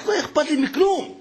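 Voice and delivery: high tearful insistence; crying child. Retold or imitated. Boy insists to his mother he cares for nothing else, tearful. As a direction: push it out through tears. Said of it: "התחיל לבכות".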